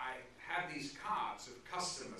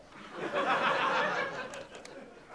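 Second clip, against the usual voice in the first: big laugh; 7 dB louder than usual. Real, not acted. Laughing at Lindsay's card.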